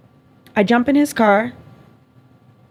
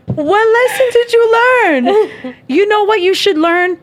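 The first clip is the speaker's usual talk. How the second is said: high, slow and louder, laughing in disbelief; incredulous mock question; 8 semitones higher, 4 dB louder than usual. Real, not acted. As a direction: stretch it out, mocking him through laughter. Mocking ex's Instagram post.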